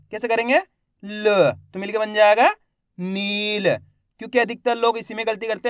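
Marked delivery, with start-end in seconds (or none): 0.6-1.5 "ल" as a bare l with a short schwa; half-sounded last letter. Shown as a demonstration. Teacher repeating for learners, "ल" only half voiced. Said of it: "तो आधा उच्चारण करेंगे". 3.0-3.7 "नील" with a stretched ee, closing on l; half-sounded last letter. Shown as a demonstration. Teacher joining the letters, "नील" drawn out. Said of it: "तो आधा उच्चारण करेंगे".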